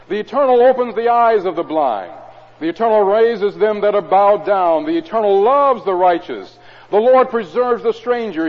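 solemn scripture proclamation; psalm reading. Acted. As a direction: lift the psalm to the congregation. Reads psalm aloud.

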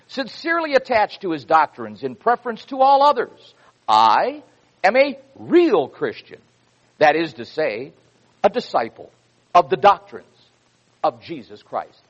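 fervent preacher's delivery; preaching a quote. Acted. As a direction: proclaim it to the congregation like scripture.

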